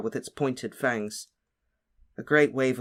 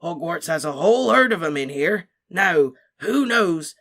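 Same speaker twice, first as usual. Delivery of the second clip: hearty Hagrid; 4 semitones higher, 7 dB louder than usual. A narrator's reading. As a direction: boom like a proud gamekeeper. Hagrid voice in story.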